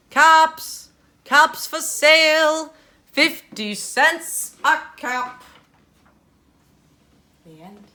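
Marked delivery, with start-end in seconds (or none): 0.1-5.4 much louder, slow street-seller call; calling out wares. Acted. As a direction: cry your caps to the town. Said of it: "he walked back to town calling".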